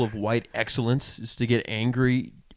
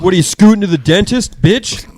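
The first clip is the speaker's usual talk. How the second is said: higher, louder jeering taunt; mock taunt; 8 semitones higher, 8 dB louder than usual. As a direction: hurl it like a heckler. Friend mocks the guy.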